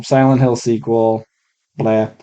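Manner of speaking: ordinary talk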